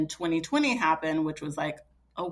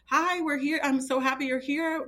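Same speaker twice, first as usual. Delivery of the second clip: put-on higher, sweet baby-talk hello; lightly voiced newborn greeting; 8 semitones higher than usual. Mother retelling how she greeted her limp newborn, a light high cooing voice.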